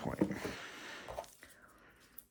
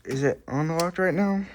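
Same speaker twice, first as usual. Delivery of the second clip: out of breath; 6 semitones higher, 6 dB louder than usual. Real, not acted. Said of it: "Sorry if I'm breathing hard".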